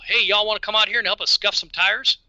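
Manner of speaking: a light Southern drawl, friendly invite. Retold or imitated. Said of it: "he would always say in a Southern drawl".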